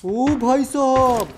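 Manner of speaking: slow, loud, stretched-out exclamation; real awed gamer reaction; drawn-out amazement. Real, not acted. Stunned reaction during gameplay.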